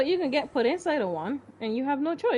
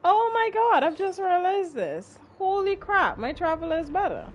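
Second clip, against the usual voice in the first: excited; 7 semitones higher, 4 dB louder than usual. Real, not acted. Realizing upgrade mid-game.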